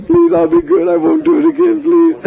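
high pleading little boy, hammed-up tears; mock crying child. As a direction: beg like a naughty child caught red-handed. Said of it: "he's just crying".

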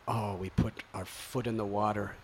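normal talking voice

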